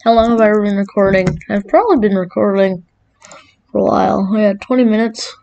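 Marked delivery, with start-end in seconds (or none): none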